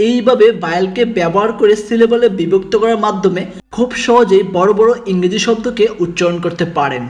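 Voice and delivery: usual speaking voice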